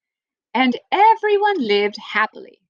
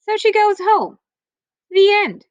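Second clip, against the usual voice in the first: punchline; 9 semitones higher, 5 dB louder than usual. A narrator's reading. Abrupt punchline of silly story.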